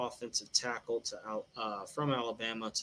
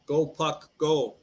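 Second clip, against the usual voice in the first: cheering; 3 semitones higher, 6 dB louder, slower than usual. Real, not acted. Cheering on his parlay.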